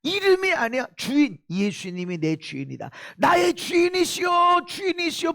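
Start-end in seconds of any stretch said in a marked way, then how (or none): 3.2-5.3 crying out to God. Shown as a demonstration. Preacher showing believers what "Lord" means, crying out. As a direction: call out to your Master, stretching the words. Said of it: "부르짖는 겁니다".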